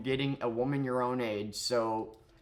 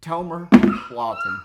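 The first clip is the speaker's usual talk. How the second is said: loud, higher announcer call; mock announcer; 6 semitones higher, 13 dB louder than usual. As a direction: boom it like a ring announcer. Announcing the name.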